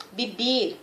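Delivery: ordinary talk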